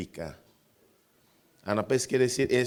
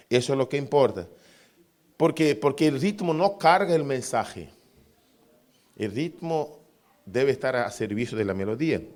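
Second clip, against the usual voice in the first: ordinary talk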